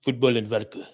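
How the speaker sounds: light put-on Russian accent. Shown as a demonstration. Practising the requested accent, light Russian accent. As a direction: say it as a would-be Russian. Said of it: "do it in a Russian accent".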